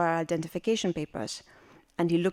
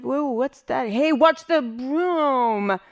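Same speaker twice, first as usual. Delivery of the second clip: slow, higher-pitched stoner drawl; stoned Hollywood lizard; 5 semitones higher, 4 dB louder, much slower than usual. Acted. Stoned lizard greeting the woman with the broom, spaced-out drawl. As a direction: drag out the words like someone totally stoned. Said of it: "totally stoned".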